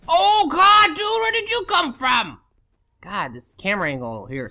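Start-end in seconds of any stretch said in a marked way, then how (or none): 0.1-2.4 very high, loud shriek of alarm; real jump-scare outburst, voice shooting up; startled yell. Real, not acted. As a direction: cry out as if ambushed. Enemy appears by surprise.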